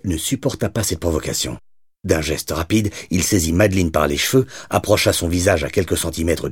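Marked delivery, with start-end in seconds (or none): none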